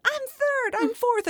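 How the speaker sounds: put-on high toddler voice announcing it; proud toddler. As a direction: squeak it out like a proud little kid. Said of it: "And it goes on like that".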